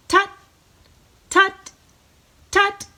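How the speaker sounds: short high "tut" notes; light robin-call imitation. Shown as a demonstration. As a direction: peep like a robin. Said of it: "So let's do that three times".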